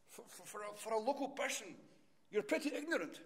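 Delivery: indignant English driver, pitched high; English accent rising in exasperation; English tourist snapping at the farmer. Retold or imitated. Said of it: "in this English accent".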